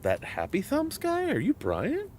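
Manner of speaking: high, soft put-on fan voice; imitated fan. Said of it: "when I get that occasional".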